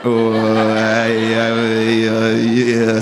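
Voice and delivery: low droning didgeridoo wobble on one note; mock hesitant answer as didgeridoo drone; didgeridoo impression. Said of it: "a little didgeridoo sound".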